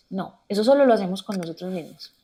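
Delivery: ordinary delivery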